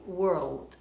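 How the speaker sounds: world with a curled R, then L on the palate; American pronunciation. Shown as a demonstration. Teacher showing American world. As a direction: roll the tongue in world. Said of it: "enrolada na língua".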